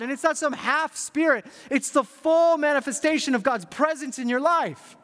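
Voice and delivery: high, emphatic preacher's build; impassioned preaching. Real, not acted. Preacher urging the church they carry God's presence, impassioned. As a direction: drive each phrase home, rising in pitch and fervor.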